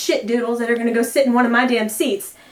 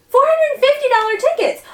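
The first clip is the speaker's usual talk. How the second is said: indignant repeat, pitched higher; outraged, higher; 12 semitones higher than usual. Real, not acted. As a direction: spit the price out in disbelief.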